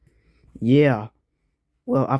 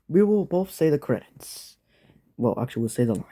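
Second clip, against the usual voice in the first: usual speaking voice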